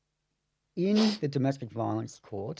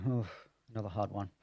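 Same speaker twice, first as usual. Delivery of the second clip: sighed, eye-rolling resignation; mock weary; 2 semitones lower, 6 dB quieter than usual. Lawyer reacting to yet another client with a protection order, mock weary. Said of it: "I tend to roll my eyes and go".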